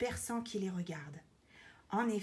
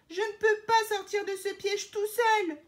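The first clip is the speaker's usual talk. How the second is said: high, loud, distressed wail; imploring; 11 semitones higher, 8 dB louder than usual. A narrator's reading. Elephant explaining he is stuck, imploring. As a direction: plead helplessly at full voice. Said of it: "implore Éléphant".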